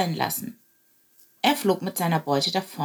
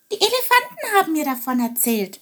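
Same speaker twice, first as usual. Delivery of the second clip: high sparrow voice; 9 semitones higher than usual. Acted. Chilp answers raven.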